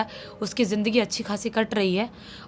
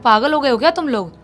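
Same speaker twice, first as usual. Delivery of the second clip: scolding; 4 semitones higher, 5 dB louder than usual. Retold or imitated. Uncle scolds boys at night.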